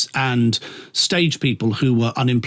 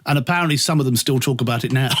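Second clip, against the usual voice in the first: ordinary talk, nothing marked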